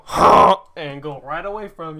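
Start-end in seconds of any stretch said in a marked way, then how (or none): 0.0-0.6 goose honk. Retold or imitated. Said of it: "that thing's gonna go".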